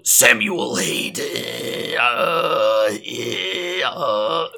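deep, slow robot growl trailing into metallic noises; Samuel Hayden. Shown as a demonstration. Son contrasts his two character voices, deep metallic robot growl. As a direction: drag it out into a low mechanical rattle. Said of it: "If you listen to the Guy Stuff versus the".

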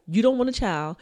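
ordinary talk, nothing marked

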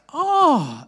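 high, loud startled "Oh"; a squeak of surprise; surprised oh. Retold or imitated. Teacher hearing the answer, startled oh. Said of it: "She's like".

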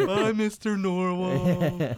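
slow, high, loud, laughing; Elf quote laughing. Retold or imitated. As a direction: drag it out through a laugh. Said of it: "the scene in Elf".

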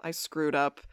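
low, soft retold admission; dejected. Retelling Damon at the bar, mildly dejected. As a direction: own up quietly, lightly glum. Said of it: "sitting there kind of dejected".

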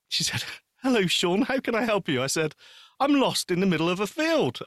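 ordinary delivery

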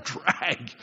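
low, weary complaint; mock dejection. Churchgoer facing work on Monday, dejected. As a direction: groan it like a chore. Mimics gloomy riser.

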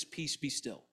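very soft, low command; acted calm authority, hushed and low; quiet command. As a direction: still the storm with a hushed word. Jesus calmly commanding the storm.

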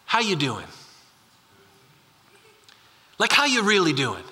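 sincere check-in. Real, not acted. Pastor asking the congregation how they are, sincere and low.

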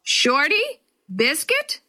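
high, rising questioning call. A narrator's reading. Dad searching yards for the dog, high questioning call. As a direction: call each name out, lifting the pitch at the end. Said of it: "Then louder he called".

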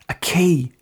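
high-pitched, pleased announcement; proud. A narrator's reading. Girl handing over her gift, proud. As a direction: present it proudly. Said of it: "she said proudly".

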